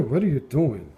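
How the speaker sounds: ordinary delivery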